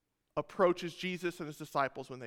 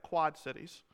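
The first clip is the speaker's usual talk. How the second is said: ordinary talk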